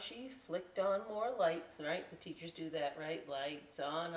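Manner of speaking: ordinary delivery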